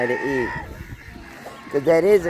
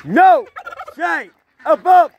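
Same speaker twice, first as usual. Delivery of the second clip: calling from far away. Real, not acted. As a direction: shout for the dog to come. Calling dog Shane from afar.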